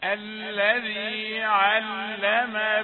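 slow, melodic, sustained chanting; Quranic recitation. Acted. Teacher recites the sura for students to follow, slow chanting. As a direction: chant each verse slowly and let it ring.

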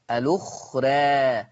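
الأخرى with the last vowel tilted toward "ee"; drawn-out fronted ending on الأخرى; taqlil. Shown as a demonstration. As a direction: say الأخرى slowly as "al-ukhrae". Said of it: "مقلل ليه قولا واحدا".

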